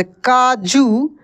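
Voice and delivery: काजू said slowly, long ऊ in जू; slow stressed word. Shown as a demonstration. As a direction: draw out काजू. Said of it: "लिखना हो".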